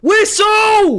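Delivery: desperate shout for the whistle; pleading with the referee. Real, not acted. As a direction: yell it high at the ref. Begging ref to end game.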